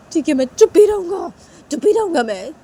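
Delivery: acted sulking child; resentful giving-in. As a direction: give in crossly, still offended. Sanju gives in, resentful.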